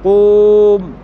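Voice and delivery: قوم said loud with a long drawn و; long vowel stretched out. Shown as a demonstration. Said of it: "هذا ساكن وهذا ساكن". (قوم بواو ممدودة بصوت عالٍ)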